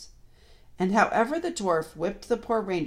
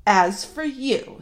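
slow, loud, higher-pitched address; menacing witch; 7 semitones higher, 8 dB louder, much slower than usual. A narrator's reading. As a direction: round on someone with drawn-out threat. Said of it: "said the witch".

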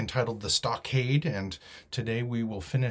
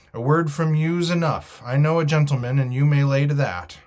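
louder, higher, hearty call; cheery shout; 5 semitones higher, 8 dB louder than usual. A narrator's reading. Long John trusts the captain as a gentleman, cheery shout. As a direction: call out your agreement cheerfully. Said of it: "shouted Long John cheerily".

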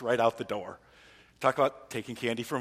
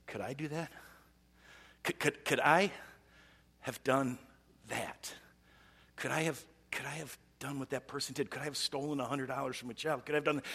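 inner question; 7 dB quieter than usual. Retold or imitated. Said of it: "we ask this question".

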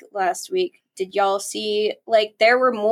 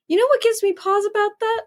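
higher, fast hammed-up imitation; exaggerated mock Paul; 9 semitones higher, faster than usual. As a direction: ham up Paul's catchphrase. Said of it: "I always just imagine him, like, doing that".

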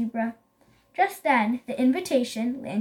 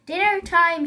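raised, loud predator's announcement; mild growl; 5 semitones higher, 6 dB louder than usual. A narrator's reading. Lion pounces over the bush, mild growl. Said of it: "he growled".

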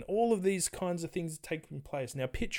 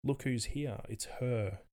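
hushed gossip; 4 semitones lower, 6 dB quieter, slower than usual. Retold or imitated. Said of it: "people might be nudging each other going".

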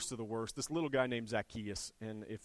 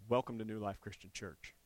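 ordinary delivery

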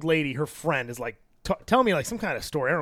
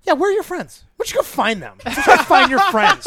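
high, confrontational wife impression; Karen voice; 11 semitones higher, 4 dB louder than usual. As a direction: imitate the wife telling someone off. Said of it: "that's a great Karen voice".

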